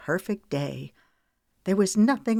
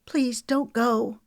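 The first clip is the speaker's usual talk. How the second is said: slow, high, pleading plea; 7 semitones higher, slower than usual. A narrator's reading. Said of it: "She looked pleadingly at Harlan".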